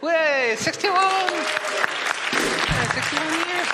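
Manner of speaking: high excited exclamation; amazed. Real, not acted. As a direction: gasp in delight at the number. Amazed at sixty-one years.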